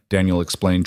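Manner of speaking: usual speaking voice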